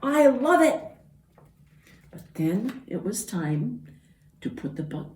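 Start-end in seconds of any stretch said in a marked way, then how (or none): 0.0-0.8 higher, louder delighted voices; much higher gleeful cheer; happy animals. Acted. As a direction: cheer it out together. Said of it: "they all said".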